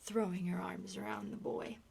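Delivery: ordinary talk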